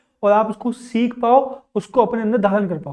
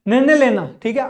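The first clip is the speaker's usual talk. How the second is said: ordinary talk, nothing marked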